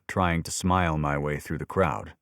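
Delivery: ordinary delivery, nothing marked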